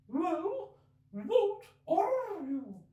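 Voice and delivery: very high, slow, fretful bird voice; lamenting pigeon. Acted. Pigeon ignoring Alice, fretful and aggrieved. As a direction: complain on and on like a fussy bird.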